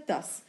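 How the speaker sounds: داس ending in a crisp s, not z; final s unvoiced. Shown as a demonstration. Teacher giving a word-final example, داس with s. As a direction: end داس on a hissing s.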